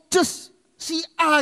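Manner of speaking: a hint of choked, slow naming; lightly voiced struggling prayer; hesitant naming. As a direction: drag the name out reluctantly.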